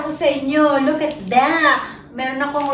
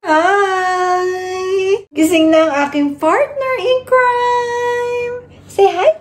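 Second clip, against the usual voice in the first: excited greeting; 6 semitones higher, 4 dB louder, much slower than usual. Real, not acted. Greeting baby in high voice.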